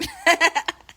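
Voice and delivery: loud, high short laugh. Real, not acted. Laughing at grandma's insult to her husband. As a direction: burst out laughing.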